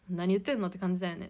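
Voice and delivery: usual speaking voice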